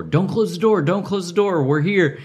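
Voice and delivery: high, urgent yell at the closing door; retold yell at the gate. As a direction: call out desperately to stop the gate agent. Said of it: "I'm yelling as she's closing the door".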